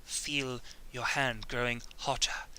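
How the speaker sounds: slow, soft, eerie whisper; slow eerie whisper of the Bene Gesserit witch. Retold or imitated. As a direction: hiss it slowly like a menacing witch. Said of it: "she's like".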